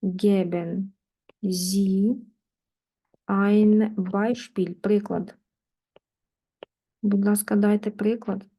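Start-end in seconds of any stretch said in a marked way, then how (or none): 0.0-4.7 slow model phrase. Shown as a demonstration. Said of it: "teacher models slowly".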